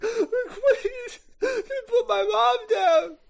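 high-pitched blubbering plea; Teddy crying. Acted. Teddy on his knees begging for his mom, crying. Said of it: "He starts crying. He's crying".

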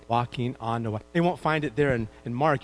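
ordinary talk, nothing marked

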